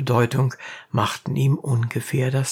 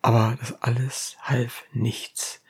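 ordinary delivery

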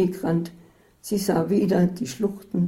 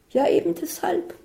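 high panic; 12 semitones higher than usual. A narrator's reading. Isabel insists in panic.

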